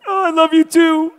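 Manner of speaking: high, loud hammed-up wailing answer; Gene's tearful reply, hammed-up crying; mock sobbing reply. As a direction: sob it back, playing the hero. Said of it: "I got tears coming down my face".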